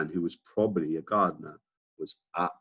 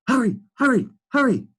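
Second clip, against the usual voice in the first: much higher, louder hurrying; urgent pelican; 7 dB louder than usual. Acted. Pelican urging Billy to hurry, much higher and louder. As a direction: urge Billy on in a panic. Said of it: "whispered the pelican".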